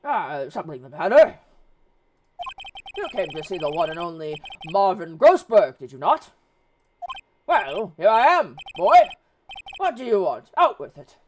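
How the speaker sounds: put-on loud, booming old lawyer role; pompous Grossberg. Voices Grossberg introducing himself.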